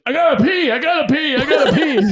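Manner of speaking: high, loud, laughing I gotta pee; urgent mock plea. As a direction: beg to pee, hammed-up and urgent. Drunk friend urgently needs to pee.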